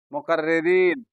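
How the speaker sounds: ordinary talk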